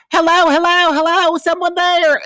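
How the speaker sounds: very high, loud mock wail; piercingly high imitation, stretched at the end; Gretchen impression, frantic. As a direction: call desperately down the phone, hammed up.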